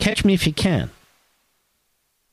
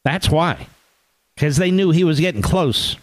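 ordinary talk

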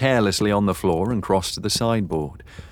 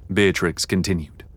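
ordinary delivery, nothing marked

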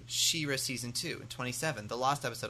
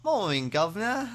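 higher, hammed-up British accent; fake Cockney greeting; 7 semitones higher than usual. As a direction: call it like a cheery movie Cockney. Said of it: "where he's like".

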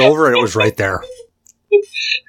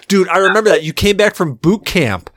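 ordinary delivery

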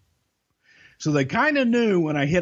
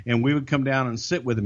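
ordinary delivery

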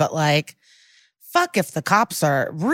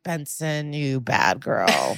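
mock scolding; 6 semitones lower, 4 dB quieter, slower than usual.

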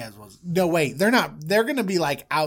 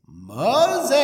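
booming call from on high, pitched up; God; 7 semitones higher, 4 dB louder than usual. Acted. Voice from the burning bush summoning Moses, high drawn-out call. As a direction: call out grandly, stretching the name. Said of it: "We know that voice".

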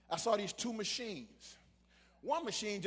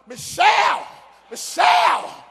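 calling out a name, much higher and louder; panicked call; 11 semitones higher, 15 dB louder, slower than usual. Retold or imitated. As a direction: yell for the nurse in panic from inside the tube. Panicking inside MRI tube calling.

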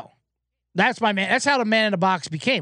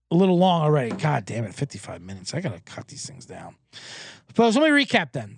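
normal talking voice

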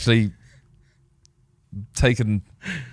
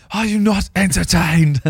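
booming arena cry, slowed; Gladiator quote; 8 semitones higher, 3 dB louder, slower than usual. Retold or imitated. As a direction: roar it to the crowd like Maximus. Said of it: "Does a proper, like".

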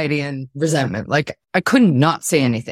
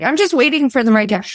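higher, fast mocking whine of an excuse; mocking quote; 8 semitones higher, faster than usual. Mocking people who wait for the right time, higher and fast. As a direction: parody the excuse, higher and quicker. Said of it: "when people are like".

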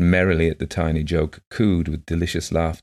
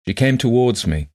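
ordinary delivery

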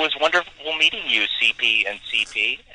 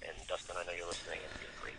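dropped to a whisper for Dustin; quiet aside; 6 semitones lower, 23 dB quieter, faster than usual. Real, not acted. Host whispering a shout-out to Dustin, hushed. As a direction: whisper it like a secret.

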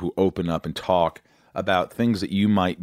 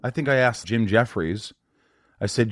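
ordinary delivery

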